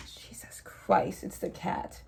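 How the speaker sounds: hushed, breathy whisper of surprise; soft startled whisper. Real, not acted. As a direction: whisper it, caught off guard. Startled by the cat.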